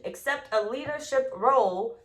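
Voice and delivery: ordinary delivery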